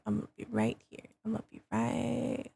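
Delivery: hushed, nearly voiceless; whispering. Real, not acted. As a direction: drop to a whisper. Whispering positioning.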